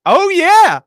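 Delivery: high, loud burst of hype; hyped-up yell. Pretend. Co-host hyping up the official intro, hyped-up yell. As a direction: belt it out with mock excitement.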